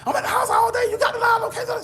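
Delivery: high mock girlfriend shouting, a laugh in it. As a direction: overplay her loud insisting she stayed home. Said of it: "She kept emphasizing on that".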